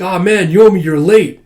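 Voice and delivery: loud, whiny-toned griping; loud complaint. Acted. Villain at dinner to late Yomi, loud complaint. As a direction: gripe loudly at a latecomer.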